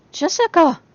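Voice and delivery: sharp loud call to snap her out of it; calling her name. A narrator's reading. Calling Jessica who doesn't answer.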